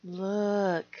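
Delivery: ordinary talk, nothing marked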